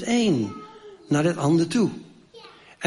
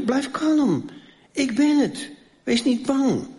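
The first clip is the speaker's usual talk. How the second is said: reassuring Jesus; 9 semitones higher than usual. Acted. Jesus calms frightened disciples.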